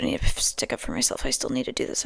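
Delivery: low unvoiced whisper, resolved; whispered to herself. Real, not acted. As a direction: murmur a promise to yourself.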